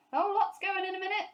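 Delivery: high, loud, fast warning; urgent shout. A narrator's reading. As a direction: yell it in a rush. Foreman's warning continued.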